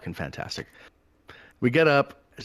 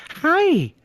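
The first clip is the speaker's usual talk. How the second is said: very high, loud mocking "Hi"; condescending greeting; 9 dB louder than usual. Armed guard answering the driver's hello, condescending. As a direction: throw the hello back condescendingly. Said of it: "back, kinda condescending".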